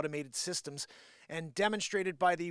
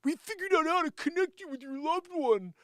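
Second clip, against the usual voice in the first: high, pushy sales voice; salesy pitch; 9 semitones higher than usual. Retold or imitated. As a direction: oversell it like a huckster.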